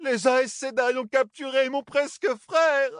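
high, slow keening wail. Acted. Servant wailing to the ceiling over the capture, high and slow. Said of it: "lancinantes lamentations".

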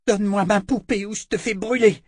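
high-pitched, forceful demand; threatening girl. Acted. Servant threatens princess with magic stick.

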